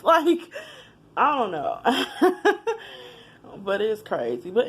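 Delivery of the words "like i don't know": laughing through held-back tears; a shaky laugh close to crying; teary laugh. Real, not acted. Laughing through tears after naming them.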